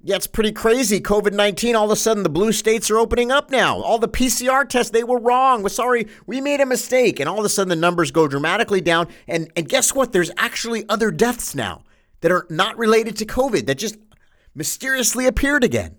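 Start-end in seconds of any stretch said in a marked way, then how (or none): 3.8-7.2 higher, mocking fake apology; sarcastic take on officials admitting error. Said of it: "I'm being a little sarcastic today". 10.1-16.0 sarcastic. Acted. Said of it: "I'm being a little sarcastic today".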